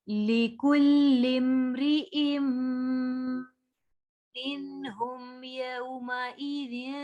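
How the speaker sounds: slow, melodic tajweed chanting; Quran recitation. Shown as a demonstration. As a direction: draw the vowels out in a slow recitation.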